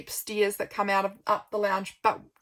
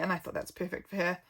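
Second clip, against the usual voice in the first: ordinary delivery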